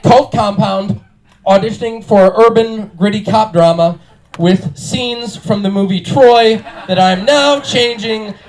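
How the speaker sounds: ordinary talk, nothing marked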